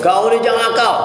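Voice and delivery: high, firm warning to a pupil; a firm teacher's warning; stern teacher. Shown as a demonstration. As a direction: tell the child off firmly. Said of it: "Marah sekali buat baik".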